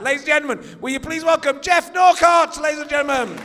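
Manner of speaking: booming showman welcome, high and loud; announcer shout. Acted. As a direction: roar the introduction to the crowd. Host introducing guest to crowd.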